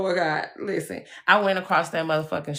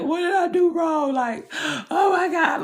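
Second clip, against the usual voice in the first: high-pitched, hammed-up begging to God; mock desperate pleading; 11 semitones higher, 3 dB louder than usual. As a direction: re-enact a distressed wail, pitched way up. Re-enacts begging God in past distress.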